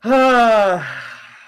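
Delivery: loud bracing exhale; heavy sigh. Real, not acted. Bracing before a confession that will make friends cringe, sighing. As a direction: let out a big, long sigh.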